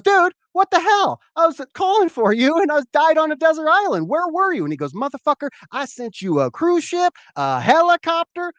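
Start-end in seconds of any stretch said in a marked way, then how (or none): 0.0-4.6 high, loud mock outrage; pissed off. Dead castaway confronting God in heaven, pissed off. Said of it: "and he's pissed". 4.9-8.5 high-pitched mock exasperation; exasperated retort. God answering the castaway's complaint, exasperated retort.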